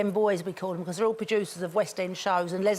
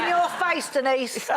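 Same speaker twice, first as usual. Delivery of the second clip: playful gloat; 6 semitones higher, slower than usual. Real, not acted. Gloating at rival.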